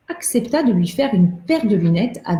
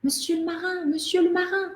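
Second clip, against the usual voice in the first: high little voice calling up from the ground; a small doll calling out to the sailor; tiny calling voice; 10 semitones higher, 4 dB quieter than usual. A narrator's reading. Doll calls up to the sailor from the quay, high and small. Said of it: "une petite voix qui lui criait".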